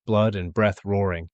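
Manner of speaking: ordinary delivery, nothing marked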